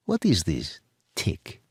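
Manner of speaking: put-on light Eastern European accent, slow and puzzled. A narrator's reading. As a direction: Eastern European woman, slow and unsure of the word. Edita puzzled by a word.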